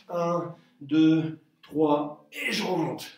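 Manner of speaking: slowed right down, each count drawn out; slow counting to model the rhythm; slow demonstration count. Shown as a demonstration. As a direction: show the tempo slowly, step by step. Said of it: "Je vous en montre une".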